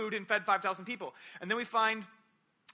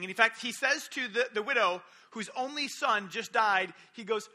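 ordinary talk, nothing marked

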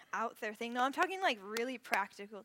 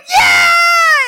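much higher, much louder yell; team yell; 25 dB louder than usual. Retold or imitated. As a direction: scream it with the whole cabin. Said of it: "And they go".

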